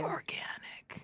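slow, drawn-out whisper; hushed whispered interjection; playful whisper. Pretend. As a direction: whisper it slowly like a secret. Whispers into the mic after whisper bit.

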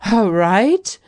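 high, loud disbelieving All right; stunned echo of good news; astonished exclamation. A narrator's reading. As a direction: blurt it out in shock. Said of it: "they both ejaculated at once".

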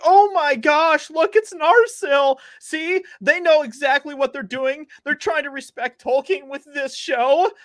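high, slow gushing fan voice, well above her own; hammed-up fangirl swooning; mocking gushing fans. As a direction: squeal like an overexcited fan gushing over a sword. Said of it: "And they're like".

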